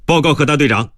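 high-pitched formal military report; cadet reporting. Acted. Cadet reporting to his commander. (学员高声向队长报告)

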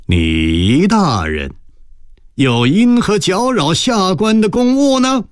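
slow drawn-out opening, climbing high; a touch of mock courtesy, stretched out; sly sneering question. A narrator's reading. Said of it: "郑春友嘿嘿笑道". (嘿嘿冷笑般拖腔质问)

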